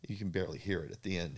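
ordinary talk, nothing marked

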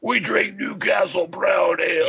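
high mock metal shout. Metal singer addressing the crowd, hammed-up high shouting impression. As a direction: parody a singer's grand stage boast. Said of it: "and it's like".

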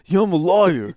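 high, loud put-on lawyer voice; mock lawyer. Co-host riffs on the lawyer who picks fights, mocking high voice.

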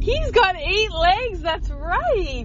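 high, bright praise for the little one; delighted praise. Real, not acted. Mother praises her son for counting legs, high delighted. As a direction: cheer him on like he did something huge.

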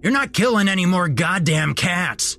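high-pitched angry yell; outraged store manager's tirade; furious manager. A narrator's reading. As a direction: blow up at him, pitch way up. Manager turning red, orders him off.

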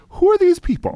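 high-pitched exaggerated incredulity; comically lost and exasperated; mock bafflement. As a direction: squeal it like a baffled listener. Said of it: "I'm always like".

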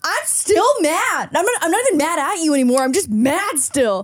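put-on high whiny teen voice, dragged out; petulant kid. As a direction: whine it like a sulky teen. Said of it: "And I'm like".

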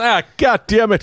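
high, hammed-up frustration; exasperated curse. Hero hears the bad news, high mock exasperation.

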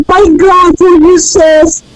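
usual speaking voice

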